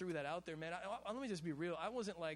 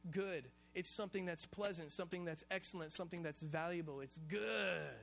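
ordinary talk, nothing marked

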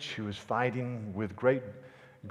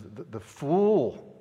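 crying out; 4 semitones higher, 7 dB louder, much slower than usual. A narrator's reading.

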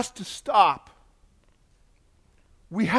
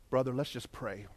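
imitating a hushed, low call to prayer; very soft and low, inviting to pray; mock-solemn, quiet pastor; solemn invitation; 6 semitones lower, 12 dB quieter than usual. Acts out David starting intimate prayer.

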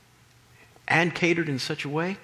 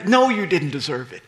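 usual speaking voice

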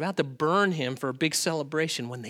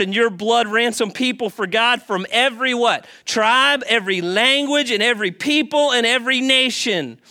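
loud, high preaching of the verse; preaching scripture; 6 semitones higher, 7 dB louder than usual. Acted. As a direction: proclaim it to the church with full force. Reading scripture with preaching force.

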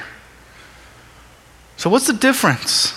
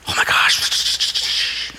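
drawn-out, awed stage whisper; gossiping whisper; 5 dB quieter, much slower than usual. Retold or imitated. Amazed crowd as word begins to spread, hushed whisper. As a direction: pass on amazing news under the breath.

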